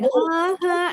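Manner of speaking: high-pitched, drawn-out puzzled "huh"; mock puzzled. Politician imitating people baffled by her accent, mock puzzled.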